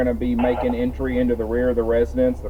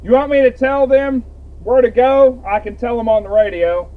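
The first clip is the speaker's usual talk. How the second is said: much higher, loud call across the yard; 12 semitones higher, 6 dB louder than usual. Real, not acted. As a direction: raise your voice to be heard. Calls out across fire scene.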